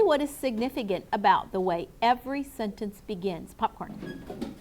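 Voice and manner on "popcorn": breathy game whisper to the class; teacher whispering the quiz cue word. Acted.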